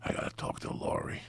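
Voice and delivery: unvoiced whisper at the door; hushed so no one wakes; man at a door at night whispering to her host, hushed.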